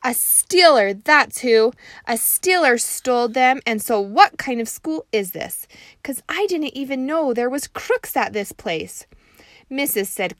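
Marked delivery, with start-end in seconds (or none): 0.0-9.1 loud, high huffy complaint; indignant kid. Acted. Junie B. complaining to her teacher, loud indignant. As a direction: huff it like an outraged first grader.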